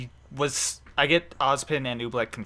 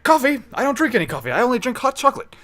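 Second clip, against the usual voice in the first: hot chocolate teacher; 10 semitones higher, 3 dB louder than usual. Pretend. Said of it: "again, like I said".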